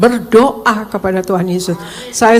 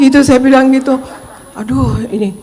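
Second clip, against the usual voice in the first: ordinary talk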